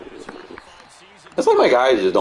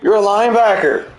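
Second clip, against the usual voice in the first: high, loud outraged shouting; real high-pitched gamer outburst; exasperated yell; 5 dB louder than usual. Real, not acted. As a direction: yell in disbelief at your player's blunder. Angry at missed tackles in game.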